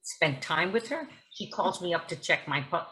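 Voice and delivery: ordinary talk, nothing marked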